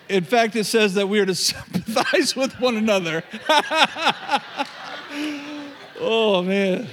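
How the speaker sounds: breaking into laughter mid-verse; can't hold the laugh in at the irony. Real, not acted. Preacher laughs at his own unkind joke, cracking up. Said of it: "Just got that wrong".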